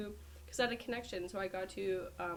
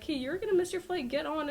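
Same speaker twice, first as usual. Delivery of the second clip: higher, louder hurried staff voice; mock urgent staff; 9 semitones higher, 8 dB louder than usual. Gate staff hurrying her aboard, mock urgent. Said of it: "and they're like".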